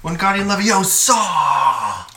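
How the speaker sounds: drawn-out, high mock wizard cry; hammed-up incantation, loud and stretched; spell casting. Friend playing at casting a Harry Potter spell, hammed-up cry.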